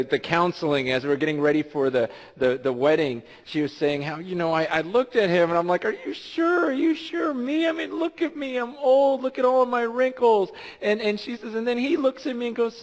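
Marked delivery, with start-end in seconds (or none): 5.8-10.5 pitched-up, self-doubting woman; a bride who can't believe she's wanted; Jean's doubt. Retold or imitated. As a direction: voice her disbelief, up high. Jean voiced doubting herself.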